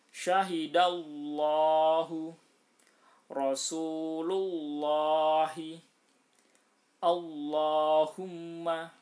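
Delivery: syahidallahu, slow and loud, with a thick, heavy lam in Allah. Shown as a demonstration. Teacher demonstrating the thick lam, slow and loud. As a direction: recite syahidallahu slowly with a full, heavy lam. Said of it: "ini harus dibaca tebal".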